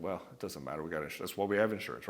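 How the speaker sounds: low soft reassuring husband; calm reassurance. Retold or imitated. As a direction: shrug it off gently for her. Calming his upset wife.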